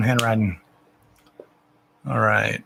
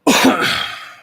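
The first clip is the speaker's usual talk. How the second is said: cough; 10 semitones higher, 6 dB louder than usual. Real, not acted. Coughing fit mid-stream.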